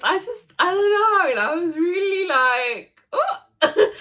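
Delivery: fighting back real tears as the memory hits; real crying. Real, not acted. New mum telling viewers about the birth, choked up and crying.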